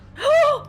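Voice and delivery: loud delighted gasp; thrilled gasp; excited gasp. Real, not acted. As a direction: gasp with sudden joy. Hammer returns.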